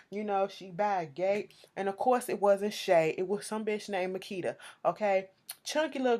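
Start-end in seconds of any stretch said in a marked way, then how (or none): none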